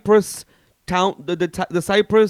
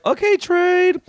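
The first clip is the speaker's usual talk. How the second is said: mock impressed; 14 semitones higher, 6 dB louder, slower than usual. Retold reaction.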